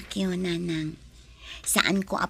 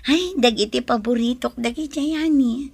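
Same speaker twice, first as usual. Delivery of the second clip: delighted; 5 semitones higher, 5 dB louder than usual. Acted. Delighted about favorite fruits.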